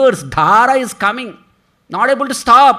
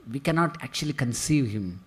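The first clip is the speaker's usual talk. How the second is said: normal talking voice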